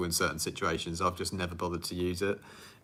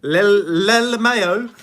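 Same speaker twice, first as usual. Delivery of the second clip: high drawn-out name; 12 semitones higher, 14 dB louder, much slower than usual. Real, not acted.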